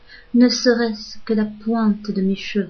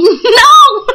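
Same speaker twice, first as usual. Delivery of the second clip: loud high cry of dismay; a shocked shout of no; 11 semitones higher, 10 dB louder than usual. Retold or imitated. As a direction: yell no in horror. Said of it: "et là je crie".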